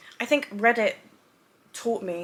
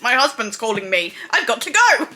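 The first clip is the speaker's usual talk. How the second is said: mock excuse; 8 dB louder than usual. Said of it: "be like".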